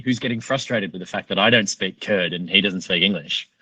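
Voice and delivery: plain reading voice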